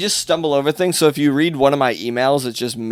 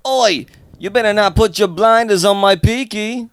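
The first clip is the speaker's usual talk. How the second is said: high-pitched attempt at a Peaky Blinders British accent; 7 semitones higher than usual. Shown as a demonstration. Comedian asked for a Peaky Blinder impression, attempting the accent. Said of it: "do a Peaky Blinder accent".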